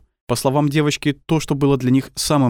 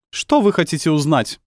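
cold, raised icy tone; 8 semitones higher than usual. A narrator's reading. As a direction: ask with chilly detachment. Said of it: "ледяным голосом спросила женщина".

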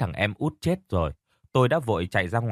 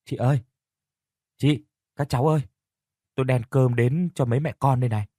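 raised voice, lightly calling into a house; 3 semitones higher than usual. Acted. Mother at the dark house door, calling out. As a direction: call for the people inside, pitch a little lifted. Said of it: "rồi kêu lên".

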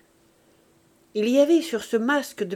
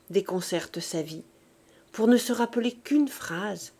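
plain reading voice

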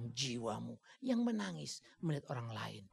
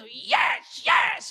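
high, loud hammed-up yes; mock cheering; 14 dB louder than usual. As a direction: ham up an ecstatic worshipper. Mocking emotional cheering.

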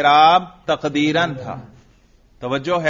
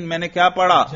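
normal talking voice

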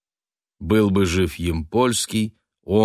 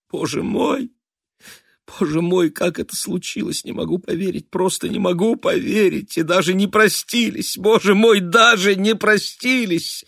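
sobbing despair; 11 semitones higher than usual. A narrator's reading. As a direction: cry it out in despair. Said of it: "отчаянно и безнадёжно разрыдалась".

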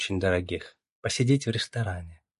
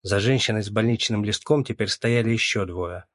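ordinary delivery, nothing marked

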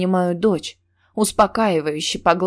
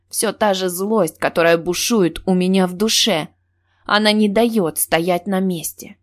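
ordinary delivery

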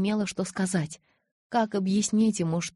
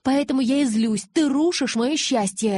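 loud, high, slowed accusation; girl angrily blaming her lover; angry reproach; 6 semitones higher, 7 dB louder than usual. Acted. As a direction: hammer the blame home. Bella angrily blaming Edward.

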